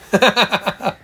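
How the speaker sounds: short bright chuckle; laugh. Real, not acted. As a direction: burst out laughing. Laughs after offering.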